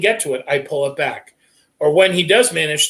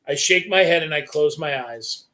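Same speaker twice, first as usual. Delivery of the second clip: plain reading voice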